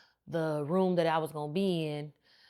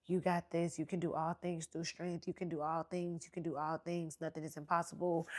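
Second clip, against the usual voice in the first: encouraging nurse; 2 semitones lower, 11 dB quieter than usual. Retold or imitated. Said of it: "she's just like".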